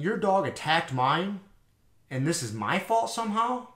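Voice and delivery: slow, stretched-out disbelieving question; incredulous, drawn-out retold comeback; retold incredulity. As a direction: imitate a baffled retort, pitch climbing high to the end. Teller's incredulous retort in retold argument.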